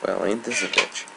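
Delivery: normal talking voice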